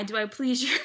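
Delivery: lines dissolve into laughter on "please"; laughing. Acted. Reader voicing Sanji cracks up mid-line, laughing. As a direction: try to say it but crack up.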